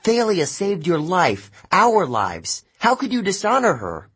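high, furious accusation; angry. A narrator's reading. Annabeth accusing Luke of betraying Thalia, furious and high. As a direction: let the anger burst out. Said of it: "Annabeth sounded so angry".

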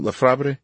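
"Lafebre" said luh-FAH-bree, unsure of it; unsure pronunciation. Real, not acted. Narrator checking a chef's last name, doubtful pronunciation of "Lafebre". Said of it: "How do you pronounce his last name?".

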